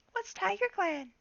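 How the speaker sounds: very high, slow little voice; small kitten voice. A narrator's reading. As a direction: squeak it like a tiny apprentice. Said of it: "mewed a small voice beside them".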